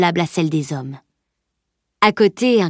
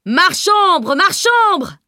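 very high shout over a crowd; alarm cry; 12 semitones higher, 4 dB louder, much slower than usual. A narrator's reading. Vendor warning the crowded market, shouting in alarm. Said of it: "Le cri avait fusé, dominant le brouhaha".

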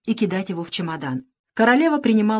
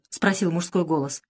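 ordinary delivery, nothing marked

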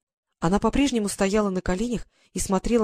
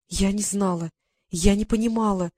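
ordinary delivery

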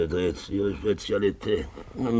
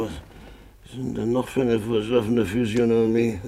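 ordinary talk

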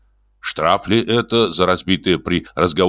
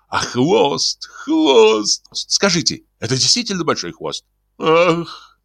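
high, ingratiating, gushing; fawning question; 6 semitones higher than usual. Acted. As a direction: flatter her with every word. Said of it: "заискивающе спрашивал". (высокий заискивающий восторженный голос)

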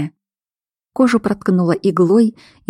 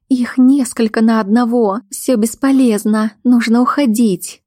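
higher-pitched, insistent warning; alarmed woman; 5 semitones higher than usual. Acted. Woman argues the fight is useless, insistent. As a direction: urge him to leave in a raised pitch. Voices woman urging escape.

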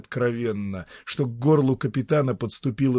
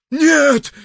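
loud, high terrified cry; shouting; 13 dB louder than usual. Acted. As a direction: scream it in horror. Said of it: "крикнул Ремедий".

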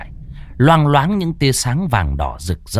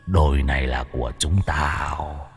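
demon voice; 6 semitones lower, 5 dB quieter than usual. A narrator's reading. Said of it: "Tên cầm đầu nói".

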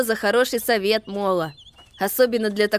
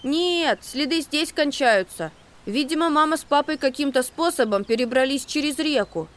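confident; 10 semitones higher than usual. A narrator's reading. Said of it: "уверенно сказал Макси".

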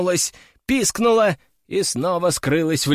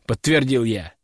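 ordinary delivery

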